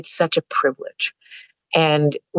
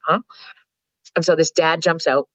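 normal talking voice

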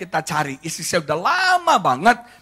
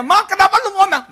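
husband's exasperated question; 8 dB louder than usual. Retold or imitated.

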